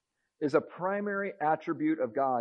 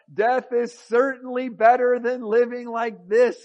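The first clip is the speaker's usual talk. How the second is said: louder, higher, a hammed-up cry of despair; overdone lament, louder and higher; melodramatic exclaim; 7 semitones higher, 8 dB louder than usual. A narrator's reading. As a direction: play the sulking prophet's wail of despair. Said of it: "he exclaimed".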